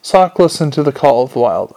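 plain reading voice

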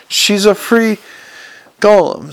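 a sharp, pointed reply, pitched a little up; a woman sharply defending the golem's freedom. A narrator's reading. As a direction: snap back in her defence, lightly voiced. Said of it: "said Adora Belle sharply".

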